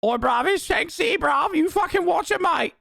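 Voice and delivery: very high, hammed-up British threat; mock British accent. Offended Brit warning of Shank City, mock British accent. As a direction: squeal it as a furious mock Brit. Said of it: "motherfuckers are like".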